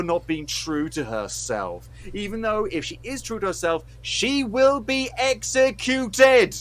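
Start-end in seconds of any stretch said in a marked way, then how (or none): 4.0-6.6 loud, high and slow, each word driven home; exasperated emphasis. Real, not acted. Host stressing the danger Mulan faces, exasperated emphasis. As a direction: hammer it out in disbelief. Outraged point of the rant.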